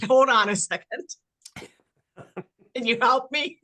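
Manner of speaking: flustered laugh mid-sentence. Real, not acted. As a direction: giggle as you ask for help. Laughing at losing her train of thought.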